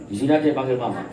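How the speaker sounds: ordinary talk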